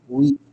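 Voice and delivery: "we" with W said as U and E as I; sound demo. Shown as a demonstration. Teacher shows students the English word, "we" sounded as U-I. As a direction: say "we" like U plus I. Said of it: "o W tem o som de U".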